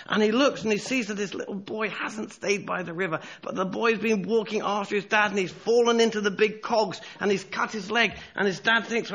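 high, pressing sermon narration; urgent storytelling. A narrator's reading. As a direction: tell the crisis with rising alarm.

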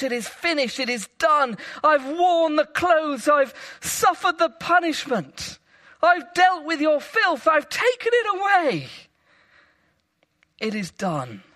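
shouting from the cross. Acted. Jesus shouting to everyone from the cross, triumphant cry. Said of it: "As Jesus shouts".

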